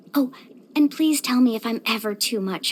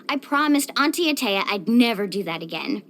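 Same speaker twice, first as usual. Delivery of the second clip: ordinary delivery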